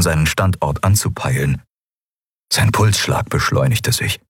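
plain reading voice

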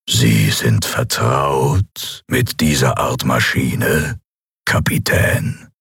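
slow, low villain voice; drawn-out, coldly polite threat; menacing armored emperor. A narrator's reading.

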